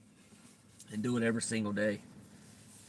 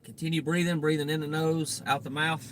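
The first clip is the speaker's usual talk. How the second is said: louder breathing cue; guided meditation; 7 semitones higher, 8 dB louder than usual. Acted. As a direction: call out the breath instructions.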